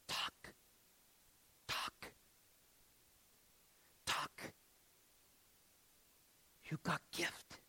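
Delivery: hushed, halting broken Slovak English. Retold or imitated. Old man urging the boy to preach, in broken Slovak English. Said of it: "very broken Slovak".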